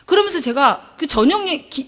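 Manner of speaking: usual speaking voice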